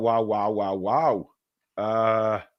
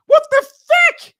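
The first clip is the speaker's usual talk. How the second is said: much higher, louder, slow outraged cry; outraged disbelief; 10 dB louder, slower than usual. Real, not acted. Streamer told his accent sounds Canadian, outraged high shout. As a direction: blurt it out in stunned outrage, high and loud.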